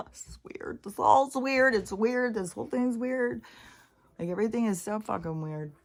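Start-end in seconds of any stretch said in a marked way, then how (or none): none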